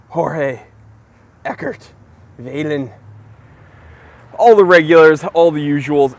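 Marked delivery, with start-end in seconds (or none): 4.4-6.1 louder burst greeting the regulars; excited shout-out. Real, not acted. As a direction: hype up your regular crowd.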